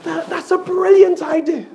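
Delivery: high, over-the-top raving praise; gushing husband. Retold or imitated. Husband lavishly praises his wife's beans on toast, high and gushing. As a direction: rave about the toast like it's a feast.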